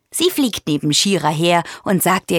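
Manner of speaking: plain reading voice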